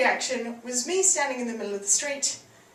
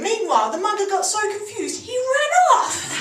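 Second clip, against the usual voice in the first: ordinary talk, nothing marked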